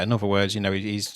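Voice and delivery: ordinary talk, nothing marked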